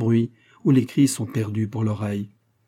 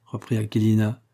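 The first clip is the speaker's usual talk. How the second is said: plain reading voice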